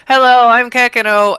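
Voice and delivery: high, loud chirpy creature greeting. Acted. Kekino greeting the hostile crowd, high and loud. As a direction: chirp it out like a cheerful little beast.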